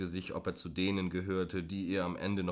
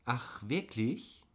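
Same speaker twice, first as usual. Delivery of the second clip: put-on higher, slow, girlish innocence; 5 semitones higher, slower than usual. A narrator's reading. Woman mocking the driver, girlish and slow. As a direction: play the naive little girl. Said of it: "fragte sie mädchenhaft".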